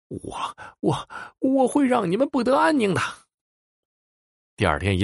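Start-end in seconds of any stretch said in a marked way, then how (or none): 0.1-3.0 breathless stammer, then a shrill high yell; furious stammering yell. A narrator's reading. Big Mouth, humiliated before the crowd, yelling a threat in fury. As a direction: stammer in rage, then explode upward. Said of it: "气急败坏地叫嚷着".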